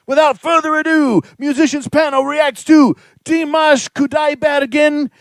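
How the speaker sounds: high, loud show announcer; hype announcer. Acted.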